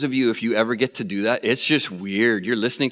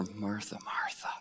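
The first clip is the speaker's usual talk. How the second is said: gentle; 5 semitones lower, 15 dB quieter than usual. Retold or imitated. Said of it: "Other translations are".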